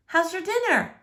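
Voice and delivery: a hint of a caring mom; mom's voice. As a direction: voice the mother gently asking. Mom voiced in dinner conversation.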